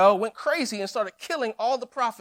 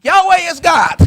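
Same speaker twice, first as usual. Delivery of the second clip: full triumphant shout, much higher and louder; crowd shout; 5 semitones higher, 12 dB louder than usual. Acted. The people proclaim after the fire falls, shouting. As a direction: roar it out like the whole crowd. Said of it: "And all the people said".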